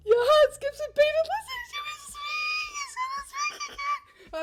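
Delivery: very high-pitched giddy squealing; squeaky, overexcited and laughing at the top of your range; fangirl squeal. Real, not acted. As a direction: squeal the words through excitement. Squeals before crush speaks again.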